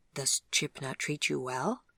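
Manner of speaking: usual speaking voice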